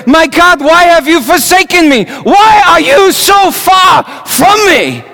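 crying out to God. Retold or imitated. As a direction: cry it out to the heavens. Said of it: "crying out to him".

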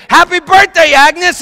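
high, full-voiced surprise-party yell; screamed greeting. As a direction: imitate a crowd screaming congratulations. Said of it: "They all screamed".

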